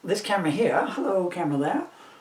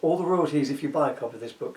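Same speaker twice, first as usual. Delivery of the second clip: usual speaking voice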